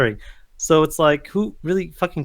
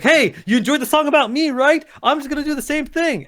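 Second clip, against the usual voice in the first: mock Idris Elba; 8 semitones higher than usual. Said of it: "Idris Elba comes back, like".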